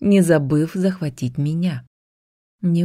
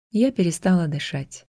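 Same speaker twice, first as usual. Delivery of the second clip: ordinary delivery, nothing marked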